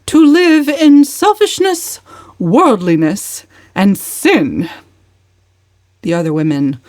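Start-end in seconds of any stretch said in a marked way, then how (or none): 0.1-4.7 high, loud, slow pious sermonizing; sanctimonious preaching. Acted. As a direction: lecture the table like a preacher, slowly. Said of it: "determined not to lose so good an opportunity".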